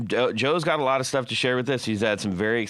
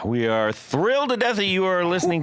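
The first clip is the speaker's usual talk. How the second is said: excited show host; 4 semitones higher than usual. Acted. Hyped show welcome.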